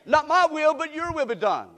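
high, loud opening of the prayer; proclaimed prayer. Acted. Preacher proclaiming the prayer to the church, loud. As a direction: proclaim it out to the room.

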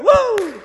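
high, loud pulpit "woo"; exultant whoop. Real, not acted. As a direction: whoop out with joy, breaking into a laugh. Preacher whoops in excitement.

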